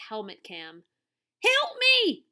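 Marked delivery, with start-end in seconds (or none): 1.4-2.3 put-on high, loud American-accented cry; cried for help. A narrator's reading. TV star dangling from the tree, panicked cry. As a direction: drop the fake accent and yell in panic. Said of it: "cried Derek Badger, suddenly no trace of Australia".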